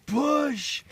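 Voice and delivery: high, loud birth-coach shout; urgent coaching. Acted. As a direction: belt it out like a delivery-room coach.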